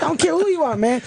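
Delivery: imitated annoyed officer; dismissive cop. Retold cop dismissing the driver.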